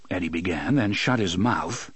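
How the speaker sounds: usual speaking voice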